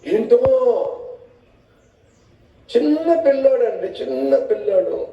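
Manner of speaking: high, fond excusing tone; mock a doting parent; hammed-up indulgent parent. Mocking parent excusing the child.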